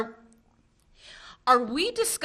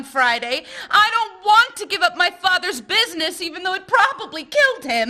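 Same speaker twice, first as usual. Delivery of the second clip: laughing through the words, a bit louder; half laughing, half desperate; laughing outpouring; 6 semitones higher, 5 dB louder than usual. Acted.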